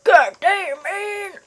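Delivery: loud, high startled yell; startled shout. Real, not acted. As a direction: yell out in fright. Jump scare reaction.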